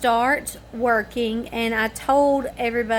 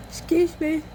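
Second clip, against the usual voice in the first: words stretched by a yawn, pitched up; 7 semitones higher than usual. Real, not acted. Apologizing to viewers through a real yawn. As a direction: excuse a yawn mid-sentence.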